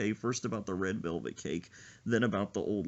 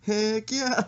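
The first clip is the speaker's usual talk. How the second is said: high, loud gleeful heck yeah; mock-eager friend's gleeful yes; eager mock cheer; 11 semitones higher, 6 dB louder than usual. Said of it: "He said".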